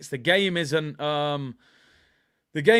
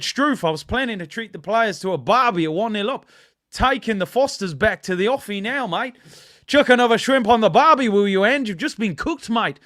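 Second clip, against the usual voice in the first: hammed-up Australian accent, higher and louder; 6 semitones higher, 7 dB louder than usual. Reading a viewer's comment aloud in a mock Australian accent. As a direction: put on a jokey Aussie accent like a larrikin. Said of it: "I better stop the Australian accent".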